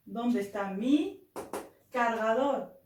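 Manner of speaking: very slow, word by word for learners; slow dictation. Shown as a demonstration. Teacher dictating the phrase to students, very slow. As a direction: spell it out slowly so they can follow. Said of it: "نقول شاحني بالإسبانية مي".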